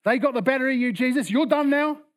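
high, loud disbelieving challenge; incredulous challenge. Acted. Onlooker challenging the dying Jesus, loud incredulous protest.

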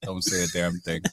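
hushed, low plea for secrecy; silent warning. Retold or imitated. Guilty friend signalling witness to keep quiet, hushed warning. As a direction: warn a friend under your breath. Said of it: "he just shook his head".

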